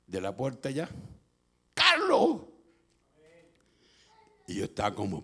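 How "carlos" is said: high, loud cry of the name; mother's call. Retold or imitated. Mother at the door calling her son, crying out. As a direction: call out urgently to your child.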